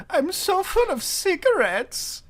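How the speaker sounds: high, slow mock meme quote; high silly meme voice. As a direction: squeak it out like a stuffed cartoon. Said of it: "I just remember going".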